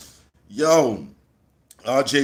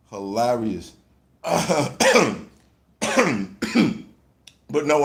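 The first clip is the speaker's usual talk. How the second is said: normal talking voice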